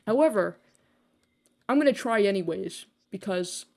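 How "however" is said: usual speaking voice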